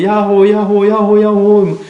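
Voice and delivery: a fading echo of yaho, high and ringing. Shown as a demonstration. Pastor mimics the mountain echo for the congregation, ringing high. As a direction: let yaho bounce back across the valley. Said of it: "메아리 쳐갖고".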